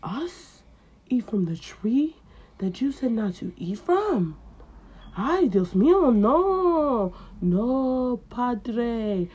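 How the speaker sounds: high, slow, fake-innocent; high melodramatic denial with Spanish; guilty denial. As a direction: lie badly with drama. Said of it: "He was like".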